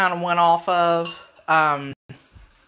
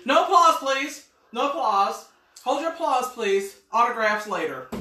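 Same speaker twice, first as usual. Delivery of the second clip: milking the applause; 6 semitones higher than usual. Pretend. Mock celebrity after tasting.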